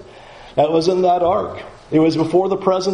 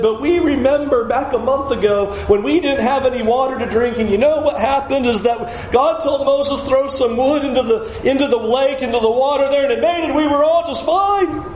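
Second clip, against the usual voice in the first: hopeful recollection; 11 semitones higher than usual. Pretend. Imagined sensible Israelite recalling miracle.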